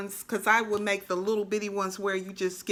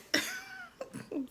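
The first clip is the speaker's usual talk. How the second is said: soft squeaky laugh; high giggle; 9 dB quieter than usual. Real, not acted. Crafter laughing at her own accent, high giggle. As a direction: giggle at your own bit.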